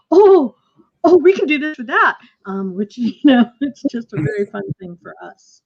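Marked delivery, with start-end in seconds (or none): 0.1-2.2 sudden high, loud burst of delight; excited discovery. Retold or imitated. Director recalling rehearsal discoveries to the cast, excited. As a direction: gasp it out like a new idea just hit.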